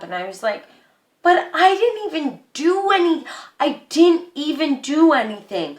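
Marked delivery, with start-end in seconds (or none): 1.2-5.8 high-pitched, defensive protest of innocence; exasperated, higher-voiced retelling of a protest; indignant protest. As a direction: plead your case, pitched up. Protesting innocence to her father, retold.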